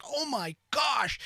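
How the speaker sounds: high, slow, hushed oh my gosh; amazed gasp. Retold or imitated. Stepping into the Irish pub, slow amazed hush. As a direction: let the wonder slow you down. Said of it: "I was like".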